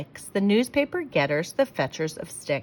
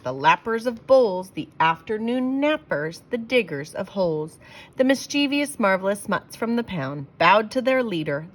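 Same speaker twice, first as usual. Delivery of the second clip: ordinary delivery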